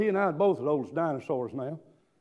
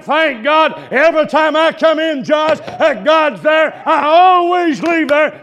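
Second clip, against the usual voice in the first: loud, higher, slow shouted preaching; full-force preaching; 7 semitones higher, 11 dB louder than usual. Acted. Preacher lifting the church, loud fervent preaching. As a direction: preach it to the back pew.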